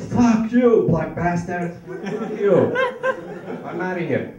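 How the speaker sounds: loud, high mock yell of abuse. Norwegian guy at the airport cursing him out, mock shouting. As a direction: bark the insults like a hostile stranger. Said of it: "some Norwegian guy was like".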